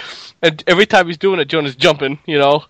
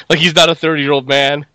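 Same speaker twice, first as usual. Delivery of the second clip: ordinary talk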